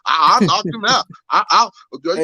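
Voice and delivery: high, cocky boast of a fighter; boastful fighter impression. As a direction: brag like you just won the belt. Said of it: "he gonna act like he beat Mike motherfucking Tyson".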